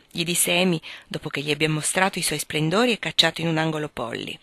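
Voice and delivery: ordinary talk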